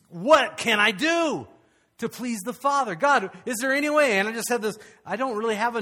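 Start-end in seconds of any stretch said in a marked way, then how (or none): 0.1-4.2 raised, louder plea to God; implore, stretching the opening question; earnest pleading. Retold or imitated.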